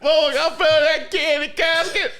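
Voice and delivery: put-on high, loud kid voice dissolving into laughter; mock child voice. Kid calling Mama about the casket, laughing impression. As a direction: call out like a kid, cracking up. Replays kid at wake, cracking up.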